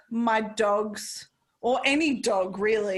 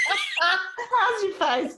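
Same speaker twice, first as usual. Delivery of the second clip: laughing; 6 semitones higher, much slower than usual. Real, not acted. Laughing at friend's reaction.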